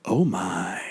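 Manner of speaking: low, hushed, drawn-out catchphrase; savored, near-whispered mock last words; George Takei impression. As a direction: sink into a low, whispery impersonation. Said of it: "a very George Takei".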